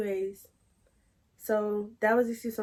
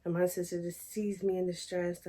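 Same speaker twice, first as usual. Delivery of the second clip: ordinary talk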